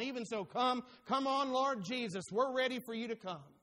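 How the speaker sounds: preacher urging Jesus to come, rising fervor; urgent plea. Shown as a demonstration. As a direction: urge Him on like calling someone home. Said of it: "urging it on".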